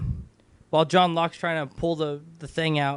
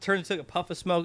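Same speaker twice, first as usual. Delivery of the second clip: ordinary talk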